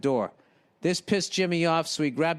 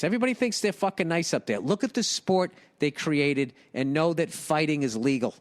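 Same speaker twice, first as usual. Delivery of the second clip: usual speaking voice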